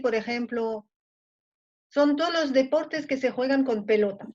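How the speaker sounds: ordinary talk, nothing marked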